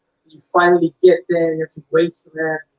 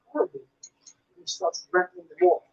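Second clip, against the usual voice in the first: normal talking voice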